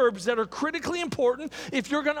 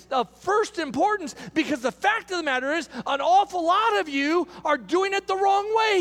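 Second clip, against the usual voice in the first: impassioned preaching; 5 semitones higher than usual. Real, not acted. Preacher warning the congregation they fall short of Christ, impassioned. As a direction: build to high, forceful pulpit proclamation.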